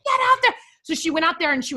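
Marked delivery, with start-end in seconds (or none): 0.1-0.5 high, loud order; mother ordering her daughter outside; mock shout. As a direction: bark the order out to the backyard. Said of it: "I was like".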